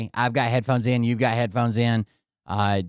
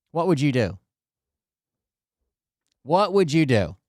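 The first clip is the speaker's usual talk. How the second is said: usual speaking voice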